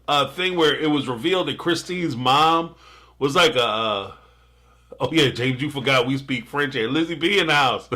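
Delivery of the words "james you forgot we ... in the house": ordinary delivery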